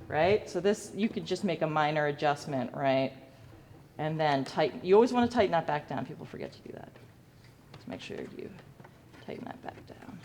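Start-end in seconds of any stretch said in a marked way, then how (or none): 6.0-10.2 whispered aside to the class; stage whisper. Real, not acted. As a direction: lean in and whisper the reminder. Teacher whispers a reminder aside.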